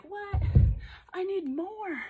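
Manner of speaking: loud, high imitation of a keen viewer; eager exerciser. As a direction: play the viewer demanding extra, high and loud. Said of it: "if you're like".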